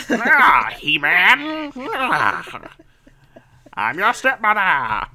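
high, loud cackling cartoon villain; eighties cartoon villain. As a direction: ham it up like a Saturday morning villain. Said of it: "I sound like an eighties cartoon villain".

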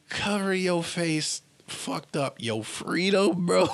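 slow, high mocking drawl; slow high scoff. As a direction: stretch it out like it's absurd. Mocking the anti-mask argument.

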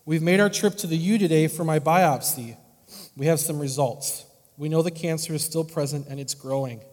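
letter read aloud. Acted. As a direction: read a sick friend's update to the congregation, earnest and full-voiced. Reads the patient's letter aloud.

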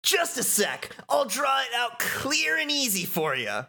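high-pitched excitable voice; high, hyped-up cartoon kid; eager game character. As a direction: pitch it way up, chirpy and keen.

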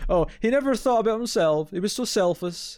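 high, breathless gushing praise of Data; mock admiring gush. Fan gushing about Data's selflessness, high mock reverence. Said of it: "I was like".

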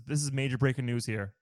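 ordinary talk

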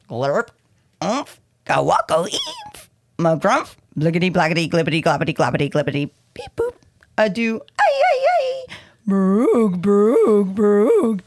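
loud goofy nonsense noises, pitch leaping; silly gibberish sounds. Acted. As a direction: blurt out each nonsense word like a cartoon. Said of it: "make silly sounds like".

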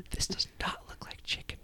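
low-volume, whispered accusation; hushed suspicion. Acted. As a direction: whisper your doubt about the dish. Master interrogates servant.

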